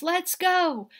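rally the friends; shout. A narrator's reading. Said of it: "he shouts".

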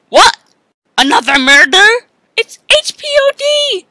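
ordinary delivery

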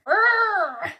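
high, loud imitation llama cry; llama noise. A narrator's reading. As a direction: bleat out a mock llama noise. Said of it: "Justin made a llama noise".

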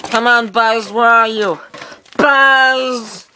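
high, loud calls up the stairs; calling out. Acted. Woody searching upstairs for his best friend, shouting. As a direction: shout for your buddy, hoping he answers.

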